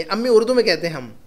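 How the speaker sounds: usual speaking voice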